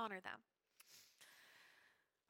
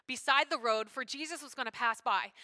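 ordinary talk

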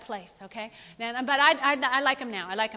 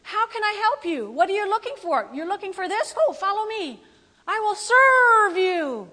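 high, sweet, overeager store greeter; eager shop clerk; 5 semitones higher, 3 dB louder than usual. Retold or imitated. Greeting a customer as a cheery salesclerk. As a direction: gush like a clerk desperate to serve. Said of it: "and they go".

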